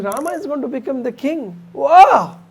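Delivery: high, loud, elated exclamation; overjoyed. Retold or imitated. Kaikeyi hearing Rama will be king, overjoyed. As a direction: burst out with acted delight. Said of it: "Kaikeyi becomes so happy".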